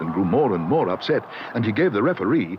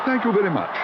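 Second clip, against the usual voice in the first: much higher, courteous thanks; polite; 10 semitones higher than usual. A narrator's reading. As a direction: thank him nicely. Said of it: "raising his hat politely".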